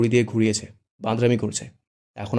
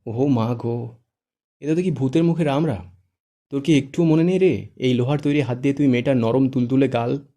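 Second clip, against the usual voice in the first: exaggerated teasing shock; astonished; 3 semitones higher, 7 dB louder than usual. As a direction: gasp out the disbelief. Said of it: "বিস্ময় কণ্ঠে বলে".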